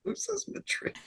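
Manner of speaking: high whispered jab; whispered aside. Real, not acted. Aside joke.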